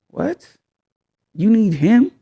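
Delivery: high-pitched, acted disbelief; incredulous. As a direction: question it in mock disbelief, voice jumping up. Acted disbelieving partner hearing the call.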